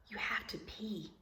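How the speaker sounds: urgent whispered aside. A narrator's reading. Teacher reading to class, whispering the urgent need. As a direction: hush it like a secret emergency. Urgent bathroom scene read aloud.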